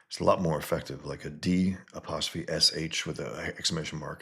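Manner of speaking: ordinary talk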